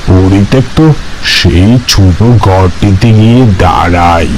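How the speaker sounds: low, hushed narrator; story narration. A narrator's reading. Narrator describing the abandoned hut, low and hushed.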